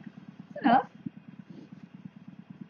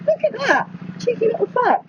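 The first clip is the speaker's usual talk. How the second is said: squeaky high voice at an animal; high teasing voice; 12 semitones higher than usual. Pretend. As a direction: pitch way up, teasing a critter.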